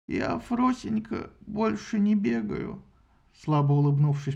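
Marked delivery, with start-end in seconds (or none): 0.1-2.8 put-on slow, soft toothless old man; old man mumbling. A narrator's reading. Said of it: "прошамкал Анхис".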